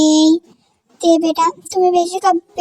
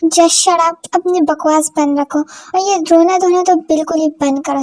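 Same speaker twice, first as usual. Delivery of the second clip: ordinary talk, nothing marked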